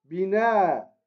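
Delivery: "Bina" ending on a long held "a"; lengthened final vowel. Shown as a demonstration. Teacher repeating the first word, "Bina" with long "na". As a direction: draw out the "na" of "Bina". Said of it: "l'allongement qu'on vient de voir".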